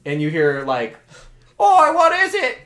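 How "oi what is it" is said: very high, loud comic yell; Monty Python guard. Acted. Guard behind the gate answering his mate, Monty Python yell. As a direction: answer in a high, hollering sketch-comedy voice. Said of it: "I'm turning into the Monty Python".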